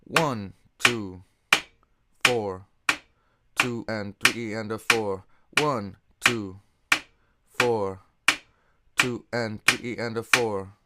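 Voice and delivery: loud counting over playing. Shown as a demonstration. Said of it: "Let me count and play this for you".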